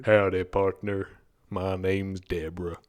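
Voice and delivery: hammed-up Texas drawl; Texan woman. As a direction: introduce yourself as Deborah from Texas. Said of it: "That was my woman".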